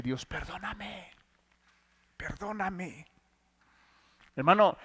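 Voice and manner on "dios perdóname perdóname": much softer, slow pleading prayer; a near-whispered plea for mercy; hushed prayer. Retold or imitated. The man begging God for forgiveness in a hushed, slow plea.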